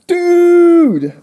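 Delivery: very high, loud, drawn-out yell; shout. Acted. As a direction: yell it in alarm, stretched out. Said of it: "someone shouts".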